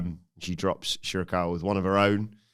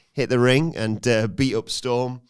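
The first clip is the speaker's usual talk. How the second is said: ordinary delivery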